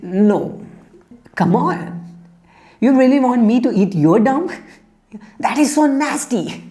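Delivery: high, squeaky bird recoiling in disgust; a small bird going up high, appalled at the offer. Acted. Bird balks at the bull's suggestion, high and disgusted. Bird refuses to eat dung.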